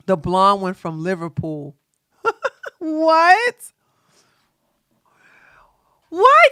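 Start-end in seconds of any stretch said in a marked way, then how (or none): 2.2-2.7 high laugh. Real, not acted. Laughs at realization.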